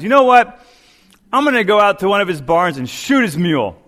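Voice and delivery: high, loud hammed-up threat; mock rage. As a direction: storm off with fake fury. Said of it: "in a rage".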